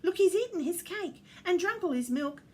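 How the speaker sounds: put-on high, loud boyish excitement; an excited child calling out; excited cry. A narrator's reading. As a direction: cry out the discovery, high-pitched. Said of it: "cried the boy".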